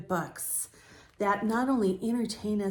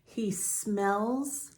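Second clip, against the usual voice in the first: slow, gentle read-aloud; story narration; slower than usual. A narrator's reading. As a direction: read it calmly to young listeners. Reads picture book to children.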